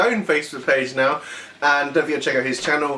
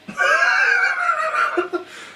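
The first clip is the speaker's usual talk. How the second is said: high squealing laugh. Real, not acted. Friend keeps declaring himself out, squealing laugh. Reacting to the warnings.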